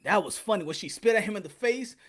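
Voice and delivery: usual speaking voice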